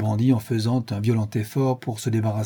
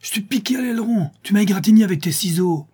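high, angry outburst, getting louder and faster; furious cry; 9 semitones higher, 4 dB louder, faster than usual. A narrator's reading. Bandit cries out after being scratched with scissors, furious and high. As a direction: cry out in outrage, pitch jumping up. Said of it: "s'écria avec un effroyable jurement".

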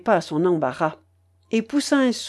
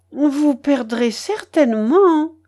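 slow, high, feline simpering; mewing; 6 semitones higher, 4 dB louder, slower than usual. A narrator's reading. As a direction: mew the warning like a cat. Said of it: "miaula mademoiselle Blanche". (miaulement traînant et aigu)

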